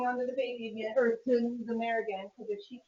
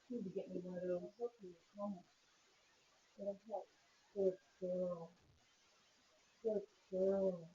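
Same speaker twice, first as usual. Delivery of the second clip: soothing murmur; 4 semitones lower, 10 dB quieter than usual. Real, not acted. Calming the mare quietly.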